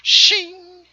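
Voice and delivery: sword shing sound. Pretend. Pulling out sword in game.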